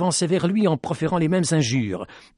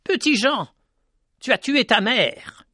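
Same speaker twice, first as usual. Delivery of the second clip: raging cry; 9 semitones higher than usual. A narrator's reading. Woman accusing Petit Jean of his mother's death, raging. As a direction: spit out her rage. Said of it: "une femme dénoua sa rage en lui criant".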